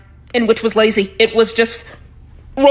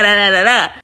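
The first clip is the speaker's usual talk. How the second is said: usual speaking voice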